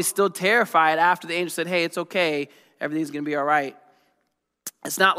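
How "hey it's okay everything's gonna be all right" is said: usual speaking voice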